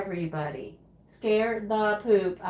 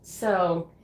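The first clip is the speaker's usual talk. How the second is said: usual speaking voice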